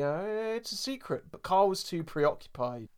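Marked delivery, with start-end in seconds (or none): 0.2-1.2 high, slow, soft mock Lenny; coy secretive line, pitched up and slowed; imitating Lenny. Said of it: "again, Lenny".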